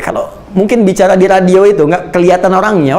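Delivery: ordinary talk, nothing marked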